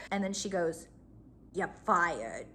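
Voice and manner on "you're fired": cold deadpan "You're fired"; no emotion. Retold or imitated. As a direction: say it totally flat and cold. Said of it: "Like, no emotion whatsoever".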